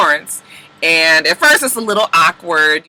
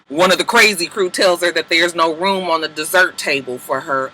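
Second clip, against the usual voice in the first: normal talking voice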